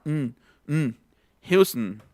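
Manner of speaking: a bare nasal "n" sound, then Houston as "HYOO-stn"; schwa ON ending. Shown as a demonstration. Teacher explaining ON takes schwa, sound demo. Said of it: "Todas las O N van con schwa".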